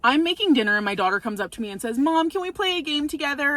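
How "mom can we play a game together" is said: put-on child voice. Daughter voiced asking to play.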